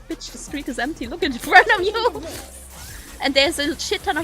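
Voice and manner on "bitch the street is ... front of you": rising to an incredulous yell; exasperated, louder at the end; mock exasperated retort. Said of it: "looking at him being like".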